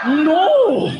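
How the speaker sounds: laughing shouted "No!"; emphatic laughing no; loud no. Real, not acted. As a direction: bark it out laughing. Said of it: "loud denial".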